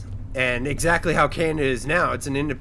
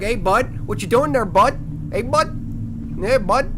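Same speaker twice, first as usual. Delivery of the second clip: high, bright-pitched Maritime "hey, bud" impression; mock an East Coast Canadian calling a buddy; Eastern Canadian accent; 8 semitones higher, 4 dB louder than usual. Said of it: "You really notice the Eastern accent with people like".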